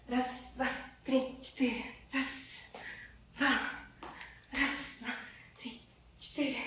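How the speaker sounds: quiet count fading into a whisper; counting workout reps. Real, not acted. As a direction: count reps under your breath, trailing off. Counting reps mid-exercise.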